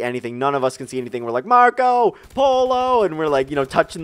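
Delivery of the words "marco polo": high, loud call into the dark. Retold or imitated. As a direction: shout it to find your friends. Calling out in pitch dark.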